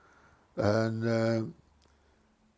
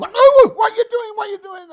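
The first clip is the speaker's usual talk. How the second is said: very high, loud panicked cry; alarmed passenger squealing at the driver; panicked shriek; 15 dB louder than usual. Retold or imitated. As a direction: shriek it like a frightened old lady. Passenger panics as bus hits curb.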